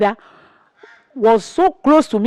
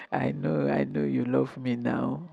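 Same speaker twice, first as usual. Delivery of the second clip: softer, coaxing tease; 9 semitones lower, 13 dB quieter than usual. Retold or imitated. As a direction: coax him gently out of anger. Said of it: "I say".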